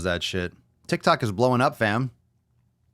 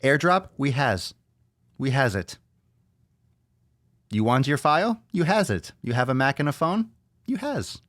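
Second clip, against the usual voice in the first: put-on broken-English accent; 5 semitones higher than usual. Apple's new slogan in a mock broken-English accent. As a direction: sell it like a silly foreign-accent ad slogan. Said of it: "With that accent".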